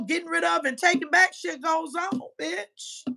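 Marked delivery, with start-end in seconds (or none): none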